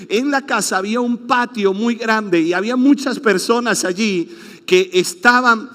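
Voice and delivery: ordinary delivery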